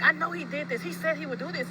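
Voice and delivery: half-performed panicked scream about the kidnapper; retold screaming. Mother whose baby is missing, screaming in a retold mock yell. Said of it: "She gets to screaming".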